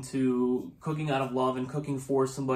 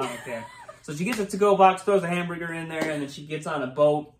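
ordinary delivery

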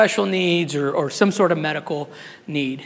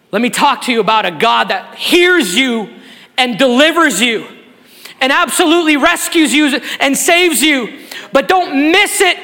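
higher, louder, building sermon voice; building toward the altar call, fervent; preaching at full force; 9 semitones higher, 5 dB louder than usual. Acted. Preaching crescendo.

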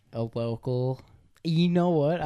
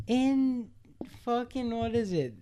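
indignant; 10 semitones higher than usual. Real, not acted. Incredulous defense of role.

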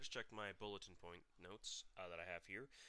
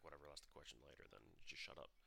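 quiet resigned inner voice; 6 semitones lower, 8 dB quieter, faster than usual. Pretend. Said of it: "I should have just been like".

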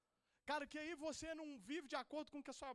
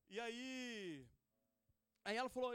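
ordinary delivery

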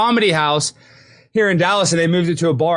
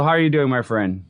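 ordinary talk, nothing marked

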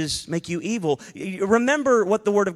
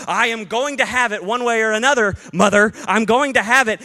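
put-on determined kid; 6 semitones higher than usual. Preacher voicing his teenage self insisting to his mother. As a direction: demand it like a stubborn teenager. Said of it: "I was like".

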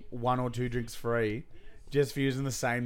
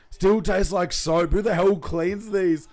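louder, slow mock-irritated complaint; mock exasperation; 5 semitones higher, 5 dB louder, much slower than usual. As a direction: act fed up with the soapy cup. Retold growing hangover frustration.

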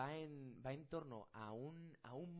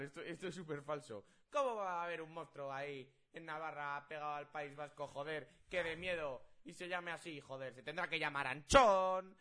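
loud, indignant ranting at mum; mock outraged rant; 3 semitones higher, 10 dB louder than usual. Son ranting loudly at his mother about the silly monster name. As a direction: rant to mum like it's a scandal. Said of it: "le decía a mi madre".

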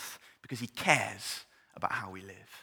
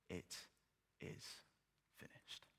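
dying last words, whispered; 21 dB quieter, slower than usual. Acted. Jesus' last words on the cross, whispered. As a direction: breathe it out like a final breath.

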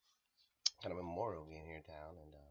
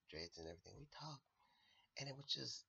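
ordinary talk, nothing marked

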